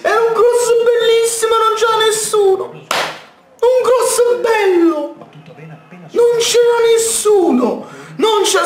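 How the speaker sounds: high-pitched wailing complaint; exasperated yelling. Real, not acted. Fan lamenting that nobody met the cross, exasperated yelling.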